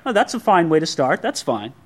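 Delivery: usual speaking voice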